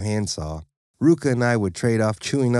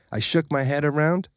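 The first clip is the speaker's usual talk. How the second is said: ordinary delivery, nothing marked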